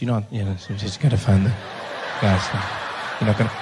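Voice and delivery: low mock office confidences; confidential office voice. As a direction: imitate hushed chat between office colleagues.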